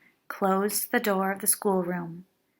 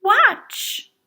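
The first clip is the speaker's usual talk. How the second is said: high loud call to watch; eager call; 13 semitones higher, 9 dB louder than usual. A narrator's reading. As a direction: call out brightly for attention. Ruby calling attention.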